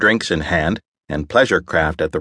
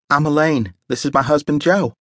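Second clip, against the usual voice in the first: cheerful woman; 8 semitones higher than usual. A narrator's reading. Elaine greets newcomers.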